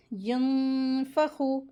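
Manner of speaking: यंफखु with a long nasal hum on the n; ghunna. Shown as a demonstration. Teacher demonstrates ikhfa, long nasal hum in यंफखु. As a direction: hum the hidden n of यंफखु before fa. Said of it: "गुन्ना करके".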